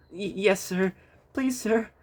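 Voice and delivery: high, stammering nervous plea. A narrator's reading. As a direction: stutter it out anxiously. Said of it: "said Percy nervously".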